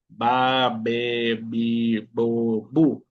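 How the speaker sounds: Ba be bi bo bu with both lips; labial b syllables. Shown as a demonstration. Teacher drills the labial B. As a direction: close the lips on each Ba, be, bi. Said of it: "es un sonido labial".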